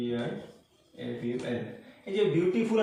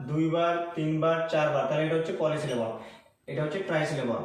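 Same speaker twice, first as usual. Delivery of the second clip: usual speaking voice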